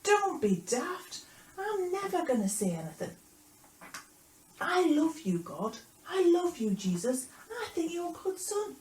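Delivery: high, loud, over-earnest protest; Peter protesting. Acted. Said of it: "And Peter was like".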